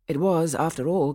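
usual speaking voice

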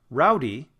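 "rowdy" with ROW punched; strong first syllable in "rowdy", weak second; stress on first syllable. Shown as a demonstration. Teacher contrasting syllables for students, "rowdy" stressed at the front. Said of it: "the first part is stressed".

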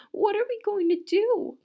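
distressed cry. A narrator's reading. Missus Cream despairing over Camilla, high anguished cry. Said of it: "cried Missus Cream".

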